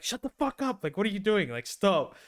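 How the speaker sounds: imitated mum telling kids off; exasperated mother. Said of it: "his mother would be like".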